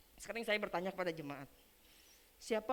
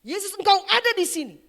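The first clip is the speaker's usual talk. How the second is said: loud, high cry to Jesus; imitated worship shout; 13 semitones higher, 16 dB louder than usual. Preacher voicing a convert in worship, full-voiced shout. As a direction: act out the convert crying out at full voice. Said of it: "dia mulai berteriak".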